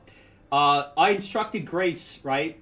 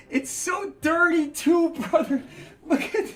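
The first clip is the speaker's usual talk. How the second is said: laughing; 10 semitones higher than usual. Real, not acted. Laughing at dirty tub.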